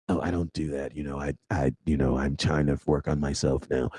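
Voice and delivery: sheepish ex voice, dropped low; mocking the ex. Mocking an ex's excuse.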